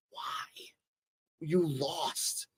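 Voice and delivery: whispered, baffled question; very soft, incredulous aside; hushed disbelief. Retold or imitated. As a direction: drop to a whisper as if pleading with a loser. Voices everyone's reaction to defeated Rodrigo.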